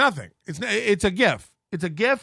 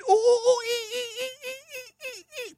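high hooting mock monkey calls; shrill mocking ape hoots; monkey noises; faster than usual. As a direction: hoot like a chimp to mock her.

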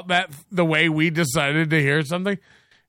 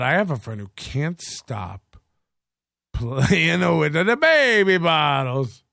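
exaggerated, drawling repetition; mock over-enunciated repeat; slower than usual. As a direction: stretch every word out as if for someone who misheard. Repeats himself to a listener who misheard.

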